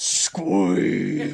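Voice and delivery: long, drawn-out elephant squeak; slow, stretched squeak in an elephant voice. Acted. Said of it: "in the elephant voice".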